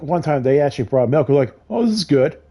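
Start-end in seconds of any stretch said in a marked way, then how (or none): 1.7-2.4 much higher, chirpy mock praise; mock cheerful. Storyteller mimicking roommates praising spoiled milk, chirpy mock. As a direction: sing it out falsely pleased.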